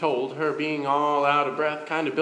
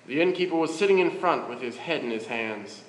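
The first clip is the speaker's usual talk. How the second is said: plain reading voice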